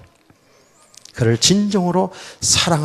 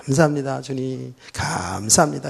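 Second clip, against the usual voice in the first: soft, sincere "감사합니다" said gently from the heart; heartfelt thanks; 7 dB quieter than usual. Shown as a demonstration. Pastor showing real heartfelt thanks, soft sincere. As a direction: thank the Lord softly, meaning it. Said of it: "우러나오는 감사는".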